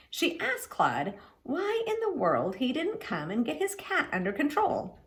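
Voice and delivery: ordinary delivery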